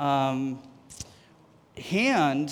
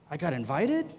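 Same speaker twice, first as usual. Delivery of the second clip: ordinary talk, nothing marked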